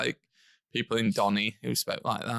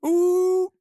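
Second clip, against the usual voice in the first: loud, very high "ooh"; high mock-impressed ooh; 6 dB louder than usual. Real, not acted. Reacting to celebrity gossip about a local town, high drawn-out ooh.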